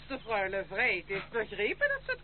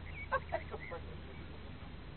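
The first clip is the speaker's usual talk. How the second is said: mocking cackle; 5 semitones lower, 14 dB quieter than usual. Grandmother's parting laugh.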